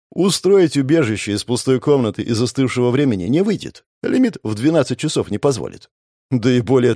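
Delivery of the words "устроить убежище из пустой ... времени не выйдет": ordinary delivery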